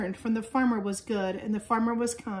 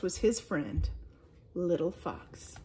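usual speaking voice

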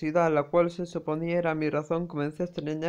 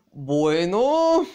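flustered exclaim; 6 dB louder than usual. Acted.

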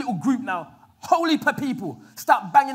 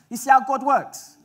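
ordinary talk

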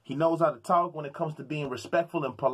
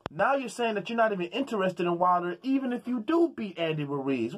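ordinary talk

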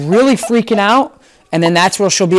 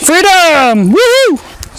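much higher and louder, cheering the dog on; joyful cheer; 13 semitones higher, 6 dB louder, much slower than usual. Real, not acted. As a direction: whoop it out with delight. Letting the dog run free.

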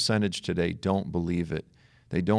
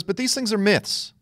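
higher, fast, breezy imitation of the seatmate; mock New York man; 12 semitones higher, 3 dB louder than usual. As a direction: toss it off like a confident New Yorker. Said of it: "He goes".

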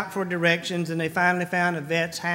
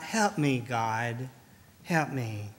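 much softer, lower plea, fading to near silence; a soft, broken prayer, much softer and lower; quiet cry for help; 8 semitones lower, 9 dB quieter, slower than usual. Retold or imitated. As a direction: let the plea sink to a hush. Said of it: "when we cry out".

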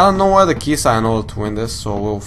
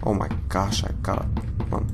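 ordinary delivery

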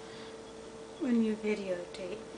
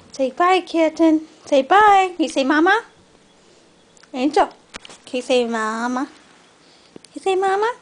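much higher, louder singsong coaxing; 6 semitones higher, 11 dB louder than usual. Real, not acted. Baby talk coaxing cat.

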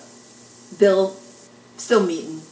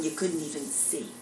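usual speaking voice

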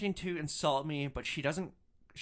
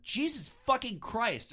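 disgusted outburst; 11 semitones higher, 4 dB louder than usual. Retold or imitated. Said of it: "I said something along the lines of".

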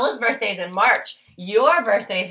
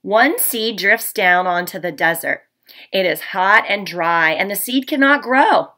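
storybook read-aloud, a little louder, with hushed asides; reading to children; 4 dB louder than usual. Acted. Teacher reading The Tiny Seed to her class. Reads picture book to class.

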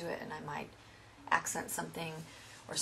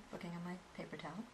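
quick whispered aside; 8 dB quieter, much faster than usual. Real, not acted. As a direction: whisper it fast, under your breath. Checking towel while painting.

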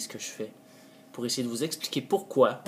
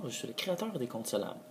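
ordinary talk, nothing marked

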